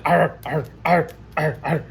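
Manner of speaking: growling through a bite; dog growls. Acted. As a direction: worry the arm like a biting dog. Dog bites the armor.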